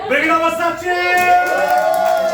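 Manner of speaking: big stretched hype shout. Real, not acted. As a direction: call it out to the crowd, slow and huge. Host hyping crowd.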